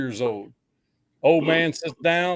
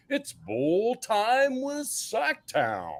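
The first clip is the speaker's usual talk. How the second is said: announcer voice; 5 semitones higher, 6 dB quieter, much slower than usual. Acted. Announcing friend's smoke session.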